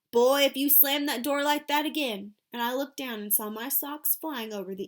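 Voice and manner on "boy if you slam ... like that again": put-on louder old-woman scolding yell; Grandma Sands yelling. A narrator's reading. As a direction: shout after the boy slamming the door. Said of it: "Grandma Sands yelling".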